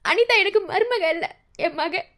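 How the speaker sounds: usual speaking voice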